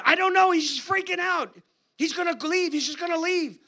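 high, breathless alarm; words tumbling out in fear, pitched high; panicked. Retold or imitated. Secretary telling the preacher her boss will quit, frantic.

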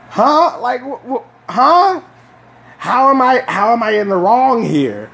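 high, disbelieving huh with a laugh; baffled protest. Retold or imitated. Kid baffled when his dad scolds him for answering, high and incredulous. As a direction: protest your innocence, totally confused.

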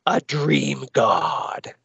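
slow, drawn-out mock-villain boom; mock villain proclamation. Reviewer voicing the villain's plan, slow mock-villain proclamation. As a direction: stretch it out like a megalomaniac announcing his destiny.

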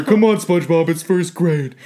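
hammed-up kid show voice; cartoon voice. Silly put-on voice.

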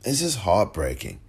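ordinary delivery

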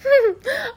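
short high closed-mouth laugh; amused giggle. A narrator's reading. Matron offering a tiny hand, amused giggle. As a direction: giggle at your own silliness. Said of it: "burst out laughing again".

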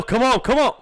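loud, high pleading cheer; excited urging. Real, not acted. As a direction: urge the team on, loud and high. Urging players during live game.

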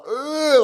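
high drawn-out imitated groan; mock groan. Acting out Rod's reaction.

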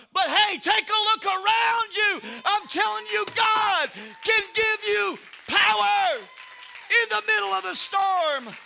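loud, high, shouted sermon climax; full-force preaching. Acted. Preacher rousing the congregation about power in the storm, shouting. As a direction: preach it out at the top of your voice.

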